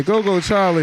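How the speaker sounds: hype call. Real, not acted. Hyping up the host.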